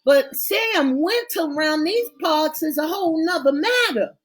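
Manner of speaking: put-on desperate pleading boyfriend; pleading young man. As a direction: beg her to understand. Blaine pleads, voice raised high.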